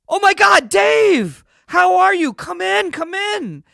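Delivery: high, loud, delighted welcome; overjoyed greeting. Acted. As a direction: hail a long-lost friend at full voice. Star joyfully greeting old friend.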